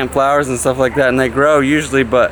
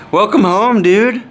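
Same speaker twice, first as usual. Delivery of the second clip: welcoming someone home; 9 semitones higher than usual. Real, not acted. Greeting son returning home.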